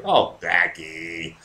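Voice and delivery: slow, lingering "Oh, Blackie"; slow teasing drawl of a name; drawn-out demo. Shown as a demonstration. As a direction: draw the name out slowly, inviting. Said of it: "You're allowed to say".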